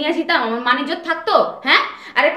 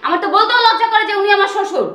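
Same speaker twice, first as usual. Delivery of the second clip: loud, higher scornful rant; angry rant; 5 semitones higher, 4 dB louder than usual. Acted. Wife ranting to her husband about his father's dirty clothes, loud and scornful. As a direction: hurl the insults at him.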